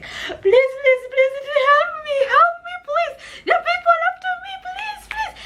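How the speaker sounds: frantic tearful begging at a stranger's gate; desperate pleading. Retold or imitated. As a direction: beg through sobs like your life depends on it.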